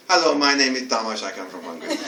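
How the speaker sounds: imitating a Hungarian speaking English, dropping very low at the end; mock Hungarian learner introducing himself; a light Hungarian accent that sinks down at the end. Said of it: "they speak like this".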